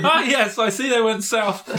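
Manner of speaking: high, laughing, knowing tone; mock-wise juggler. Juggler reading his compass, pompously pleased through laughter. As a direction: play the sage while giggling. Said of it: "pulls out his compass and goes".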